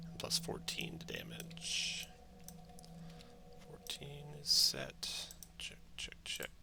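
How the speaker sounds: whispered to himself, slow; whispering. Real, not acted. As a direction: whisper the check under your breath. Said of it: "muttering to himself while checking".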